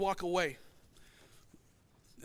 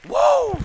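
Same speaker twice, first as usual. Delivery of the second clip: high, loud "whoa" from the pulpit; exultant whoop; 13 dB louder than usual. Real, not acted. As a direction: let out a big preacher's holler. Preacher exclaims at sermon's peak.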